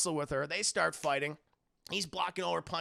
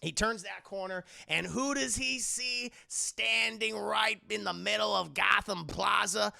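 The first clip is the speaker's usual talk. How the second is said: ordinary delivery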